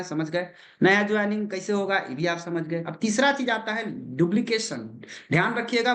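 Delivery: ordinary delivery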